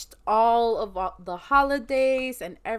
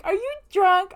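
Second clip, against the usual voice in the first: incredulous question; 10 semitones higher, 7 dB louder than usual. Retold or imitated. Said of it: "she's like".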